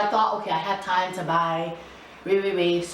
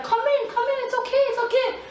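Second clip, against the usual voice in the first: much higher, coaxing driver voice; coaxing bus driver; faster than usual. Retold or imitated. Driver urges embarrassed girl back onto bus, high coaxing voice. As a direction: wave her back on kindly, pitched way up. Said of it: "The bus was like".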